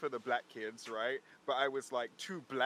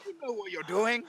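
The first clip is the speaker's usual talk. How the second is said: laughing accusation; 9 semitones higher, 6 dB louder than usual. Retold or imitated.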